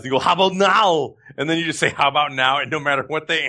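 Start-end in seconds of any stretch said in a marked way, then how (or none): 0.2-1.1 higher, thick mock Austrian accent; hammed-up Austrian action-star punchline; Arnold Schwarzenegger. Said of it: "in an Arnold Schwarzenegger voice".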